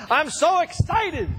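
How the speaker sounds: high, slow, drawn-out gush; gushing friend. Retold or imitated. Friend back from Jen Temple's program, gushing slowly and high. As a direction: imitate the friend's swooning excitement. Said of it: "he came back and he says".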